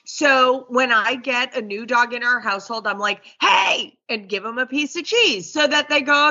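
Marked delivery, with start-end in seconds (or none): 3.4-4.0 sharp high yelp of a call; a yelled hey at the new dog, higher; mock shriek. Trainer yelling at a new dog, a high shriek of a hey. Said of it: "that lady shrieks at us like a jerk".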